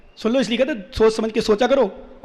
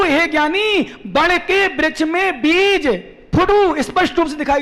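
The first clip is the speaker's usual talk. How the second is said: high, drawn-out verse explanation; slow, raised sermon delivery; emphatic preaching; 5 semitones higher, slower than usual. Real, not acted. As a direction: expound it in a ringing, stretched preacher's voice. Preaching at full force.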